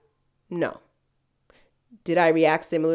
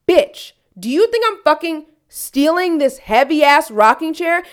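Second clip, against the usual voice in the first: louder, higher, slow furious comeback; angry retort; 7 semitones higher, 7 dB louder than usual. Retold or imitated. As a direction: tell the owner off, outraged. Retold angry clapback at owner.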